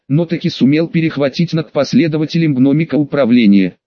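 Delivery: usual speaking voice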